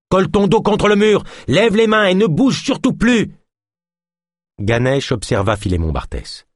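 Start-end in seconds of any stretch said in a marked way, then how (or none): 0.1-3.3 high, loud commanding shout; shouted orders. Acted. Ganesh shouts orders at the suspect against the wall, high shout. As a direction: bark it at the cornered man.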